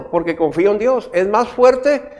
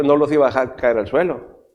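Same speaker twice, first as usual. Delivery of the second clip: usual speaking voice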